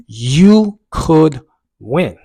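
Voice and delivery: very slow, loud, high "You ... could ... win"; drawn-out encouragement. Retold or imitated. Runner's inner voice urging him on, slowly emphatic. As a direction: stretch each word, pausing between. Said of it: "the voice kinda changed".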